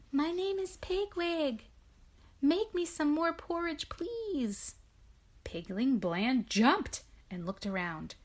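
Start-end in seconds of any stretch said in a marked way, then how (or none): 0.1-3.9 a much higher, small piggy voice; a tiny girl pig introducing herself; little voice. Acted. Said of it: "a little voice spoke". 3.9-4.8 a tiny high whisper; little whispered please. Acted. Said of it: "a little voice spoke".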